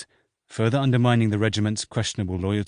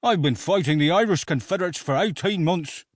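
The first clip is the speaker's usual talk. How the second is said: higher, curt officer's retort; snapping; 8 semitones higher than usual. A narrator's reading. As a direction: snap back at the troops. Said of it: "Slaughter snapped".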